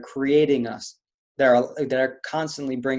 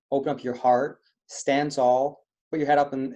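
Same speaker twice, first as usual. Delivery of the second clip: normal talking voice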